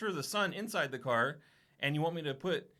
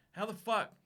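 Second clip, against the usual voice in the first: high, baffled disbelief; can't believe what's happening; incredulous outburst; 5 semitones higher than usual. Retold or imitated. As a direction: customer baffled that he can't pay. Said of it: "I'm like".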